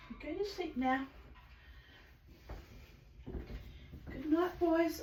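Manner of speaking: high, soft bedtime coo; soft goodnight. Real, not acted. Owner saying goodnight to her birds, high and soft. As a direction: softly send the birds to sleep.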